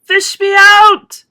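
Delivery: high, loud, drawn-out yell; yelling for rescue. A narrator's reading. Drowning boy yells to be pulled out. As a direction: stretch out a desperate yell. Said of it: "he yelled".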